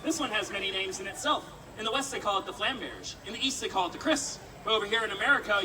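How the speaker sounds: ordinary talk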